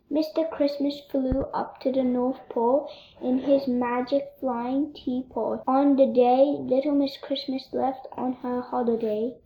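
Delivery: ordinary delivery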